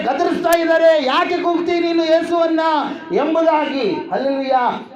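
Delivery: high, driving preacher's shout; full-force preaching. Acted. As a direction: thunder the scripture at the congregation.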